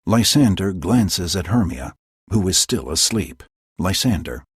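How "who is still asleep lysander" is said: plain reading voice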